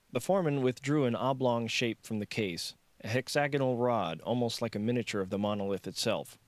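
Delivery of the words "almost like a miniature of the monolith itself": ordinary delivery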